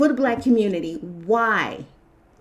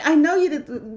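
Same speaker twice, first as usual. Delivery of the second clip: ordinary talk, nothing marked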